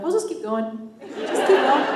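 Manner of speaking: crew's Minnesota accent, lightly mimicked. Crew answering her about carrying on, imitated Minnesota accent. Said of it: "constantly talking in the accent".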